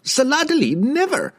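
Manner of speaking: high, frightened stammer. A narrator's reading. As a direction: stammer it out in fear. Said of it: "the merchant stammered".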